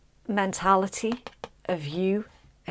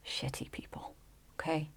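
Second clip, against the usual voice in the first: hushed whisper, no voice; whispered swear; 12 dB quieter than usual. Real, not acted. Reader warning Libra off bad company, whispered. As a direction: drop to a whisper for the rude words.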